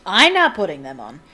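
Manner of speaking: loud indignant refusal. A narrator's reading. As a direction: refuse loudly, as an affronted old wizard. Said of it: "said old Archie in indignation".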